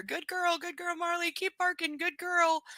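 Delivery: high-pitched praise for a dog; praising dog owner. Retold or imitated. Owner egging on her barking dog, high praising voice. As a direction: coo it high to encourage the dog. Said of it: "was telling her".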